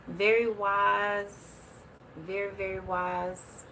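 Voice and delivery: drawn out very slowly, in awe; slow admiring. Real, not acted. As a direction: savour each word of praise. Admiring the statue.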